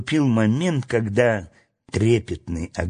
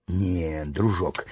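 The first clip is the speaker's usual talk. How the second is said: low, soft and tender; gently soothe, voice lowered; affectionate; 6 semitones lower, 5 dB quieter, slower than usual. A narrator's reading. Fred to the dog who wants more, affectionate. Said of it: "ласково сказал Фред".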